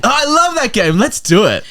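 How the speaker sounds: very high, eager gush, dropping at the end; acted excitement. Friend agreeing to play a game, hammed-up eager.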